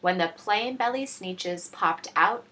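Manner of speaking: plain reading voice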